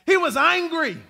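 loud, high sermon climax; fiery gospel preaching; preaching at full force. Real, not acted. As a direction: lift the room, preacher in full cry.

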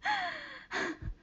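a long high-pitched giggle; high squeal of laughter. Real, not acted. Streamer cracking up at her own punchline, high giggle. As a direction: let the giggle go high and thin.

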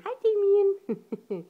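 high, loud, slow drawn-out greeting. Real, not acted. Greeting the baby.

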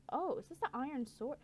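ordinary delivery

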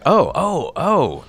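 high, falling ohs of realization; retold "aha, got it" reaction; dawning recognition. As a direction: let the penny drop in little high ohs.

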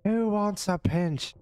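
put-on high, slow leering drunk; drunk Saint Patrick voice.

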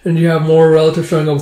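ordinary delivery, nothing marked

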